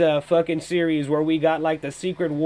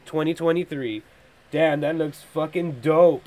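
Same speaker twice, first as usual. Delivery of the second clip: ordinary talk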